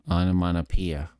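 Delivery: not through the nose. Shown as a demonstration. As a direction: say "onomatopoeia" out of the mouth, not the nose. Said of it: "is not speaking through the nose".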